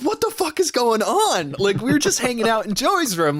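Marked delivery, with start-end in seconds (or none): 0.0-1.5 shocked. Retold or imitated. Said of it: "And I'm like".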